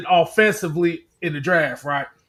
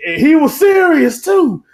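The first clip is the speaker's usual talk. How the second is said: high, loud amazement on "serious"; incredulous emphasis; 8 semitones higher, 4 dB louder than usual. Real, not acted. Host telling friends the driver meant it, loudly incredulous. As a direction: raise the voice in disbelief.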